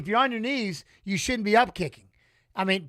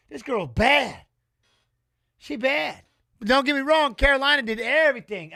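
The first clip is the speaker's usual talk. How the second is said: hyped up; 6 semitones higher, 7 dB louder than usual. Real, not acted. Hyped while watching the fight.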